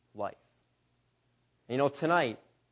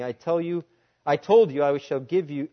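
ordinary delivery

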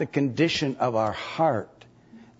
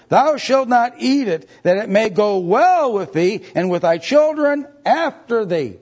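booming scripture reading; 9 semitones higher, 6 dB louder than usual. Acted. Scripture read with rising force.

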